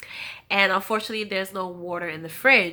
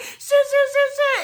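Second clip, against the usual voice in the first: a mock panicked friend, high and louder; 7 dB louder than usual. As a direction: fake alarm over the phone. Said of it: "She's like".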